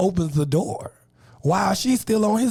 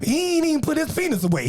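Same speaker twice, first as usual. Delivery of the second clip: ordinary talk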